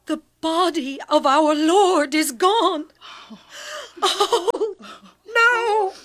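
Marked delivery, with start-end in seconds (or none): 4.0-5.9 crying. Acted. Mary finds the tomb empty.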